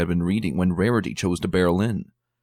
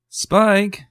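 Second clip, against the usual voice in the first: high, loud call across the library; 14 semitones higher, 6 dB louder than usual. Acted. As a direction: call him from the other room. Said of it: "Twilight called".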